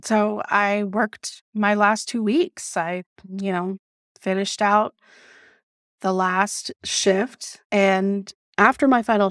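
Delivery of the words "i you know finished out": ordinary delivery, nothing marked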